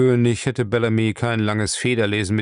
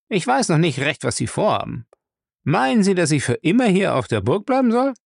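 high, pushy thief's voice; cheeky thief Lacey, discontented; 7 semitones higher than usual. Acted. Thief questions his master about his plans, pushy. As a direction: press the boss for answers.

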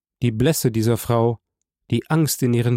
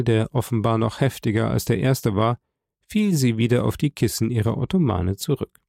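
ordinary delivery, nothing marked

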